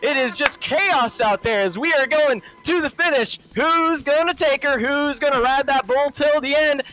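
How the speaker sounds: hammed-up Southern race commentary, drawn-out names; terrible Southern accent. Pilot parodying a friend's commentary, exaggerated Southern accent. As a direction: drawl the race call in a mock Southern accent. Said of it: "my terrible impersonation of a Southern accent".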